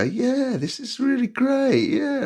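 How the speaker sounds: high, slow mock-enthusiastic yeah; fake enthusiasm. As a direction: pretend to love music you don't get. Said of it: "you know those times where you just go".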